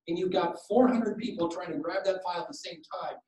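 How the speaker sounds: ordinary talk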